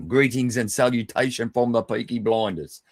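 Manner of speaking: a self-admittedly bad Birmingham Peaky Blinders accent. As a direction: try on a clumsy Brummie Peaky Blinders accent for the greeting. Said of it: "Really bad at accents, I know".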